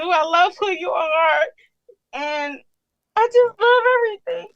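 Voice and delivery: on the verge of sobbing, pushing the words out; crying. Real, not acted. Caller overwhelmed, crying to the church preacher.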